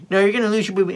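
high sing-song parent voice; acted-out talk to an infant; baby talk. Warning the baby, retold.

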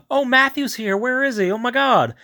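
hammed-up gleeful disbelief; mock eager surprise. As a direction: play the brother's hopeful excitement. He's like, brother is here.

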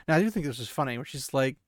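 usual speaking voice

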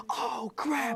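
high-pitched acted alarm; mock panic. Couple see spiders all over the walls, mock panic.

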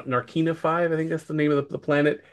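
usual speaking voice

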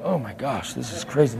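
soft, low aside; hushed disbelief. Retold or imitated. Passengers react to the screaming, hushed disbelief. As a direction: react under your breath, stunned. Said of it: "Everybody's like".

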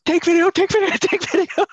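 high shouted command, laughing through it; screaming. Retold or imitated. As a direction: yell it at the camera over and over. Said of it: "I'm, like, screaming".

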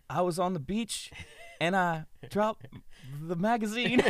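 high, halting mock testimony; Craig Robinson. Friend repeating the cover story on TV, mock recital. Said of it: "Craig Robinson's delivering the same".

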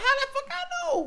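very high-pitched mock protest; retold indignant retort. Man retelling his comeback to the girl who cussed him out, indignant. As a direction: squeak it out in playful self-defence.

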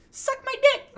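loud falsetto squeal; highest-pitched voice. Retold or imitated. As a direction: imitate a shrieking high-pitched recording. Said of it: "highest pitches that I got to".

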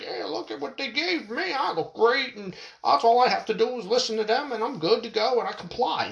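pleased conformist showing off what he was given; smug brainwashed guy. Retold or imitated. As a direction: play the smug sellout bragging about his gifts. Imitating brainwashed ex-bum.

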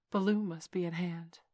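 ordinary delivery, nothing marked

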